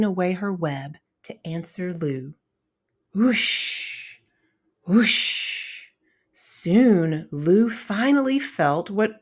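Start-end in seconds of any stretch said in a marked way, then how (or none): 3.1-4.2 breathy whooshing wind sound. Acted. Narrator imitating winter wind whoosh. Wind gusting through story. 4.9-5.8 breathy whooshing wind sound. Acted. Narrator imitating winter wind whoosh. Wind gusting through story.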